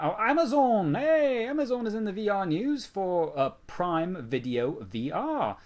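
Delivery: high, loud excited announcement. Real, not acted. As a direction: burst in with the news. Excited news intro.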